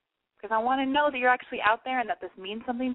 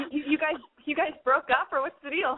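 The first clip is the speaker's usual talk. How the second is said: ordinary delivery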